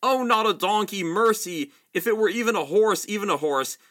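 high, loud desperate pleading; the prince pleading as he turns into a donkey, high and loud; screamed out. Acted. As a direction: beg for mercy in panic. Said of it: "he screamed out".

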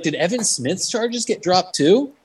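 slow, high-pitched friendly question; curious clerk. Retold or imitated. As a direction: imitate an eager dude, drawn out. Imitating evidence clerk.

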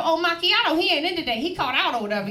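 high-pitched dismissive server voice; imitated offhand worker. Drive-thru worker to macchiato customer, imitated offhand voice.

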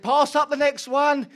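high, loud, slow call for the next one; panicky call. Retold or imitated. Calling down to his wife from wobbly scaffolding, loud panicky call.

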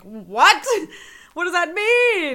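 loud, high, slow puzzled outburst; shocked first-time viewer; baffled "what?"; confused viewer. Retold or imitated. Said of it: "it's like".

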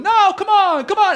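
high, loud imitation of crowd protest. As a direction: plead loudly up high like an objecting crowd. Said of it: "crowd's going".

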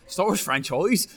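put-on rolled-r West Country accent. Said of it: "in a West Country accent".

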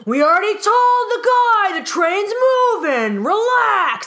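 high, loud exasperated yell at her; mock yelling. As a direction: snap back at the pushy woman, hammed-up. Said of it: "people start yelling at her".

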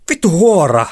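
high, loud angry shout; furious curse. A narrator's reading. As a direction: yell the insult with full force. Girl curses driver after bite. (raivokas kirous huutaen)

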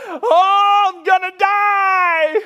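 high, loud, slow wailing terror; panicked yell. Retold or imitated. Rafter falling into the rapid, panicked wailing yell. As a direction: shriek it like you're drowning. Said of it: "He just yells up at me".

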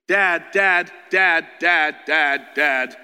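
loud, insistent calling for Dad; pestering child. Acted. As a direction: call Dad again and again.

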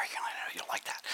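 a quick unvoiced whisper; whispered aside. Real, not acted.

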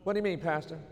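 ordinary talk, nothing marked

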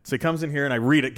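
ordinary delivery

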